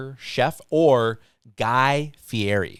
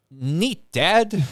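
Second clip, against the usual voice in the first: higher-pitched pretend teen put-down; mock teenage reply; 6 semitones higher than usual. Imagined teenager answers dad about smoking with Martha Stewart, mock unimpressed. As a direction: imitate an unimpressed kid answering a parent.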